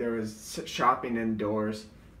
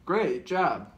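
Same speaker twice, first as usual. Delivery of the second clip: louder, slightly high audio bite; little voice; 5 semitones higher, 7 dB louder than usual. Retold or imitated. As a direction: announce it in a silly raised voice. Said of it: "just different little voices".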